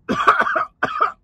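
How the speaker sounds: harsh coughing from spicy heat; coughing fit. Real, not acted. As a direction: cough hard, throat burning. Coughing after spicy bite.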